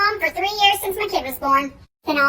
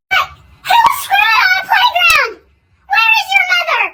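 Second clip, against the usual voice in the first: high shouted outrage; mock outraged shriek; outraged yell; 10 semitones higher, 6 dB louder than usual. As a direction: yell at the whole playground. Outrage at condom.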